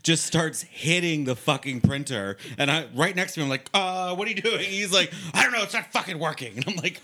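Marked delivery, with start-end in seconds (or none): 3.7-4.6 retold alarm. Startled question retold at copier. 5.3-6.6 high, loud exasperated outburst; imitating someone's worked-up complaint; retold frustration. Retold frustration with broken copier.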